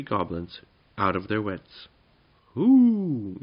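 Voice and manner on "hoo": high, loud drawn-out "hoo"; yelled hoot. A narrator's reading. Wizard startling the goblins down the chimney, yelled hoot. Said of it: "yelled the voice".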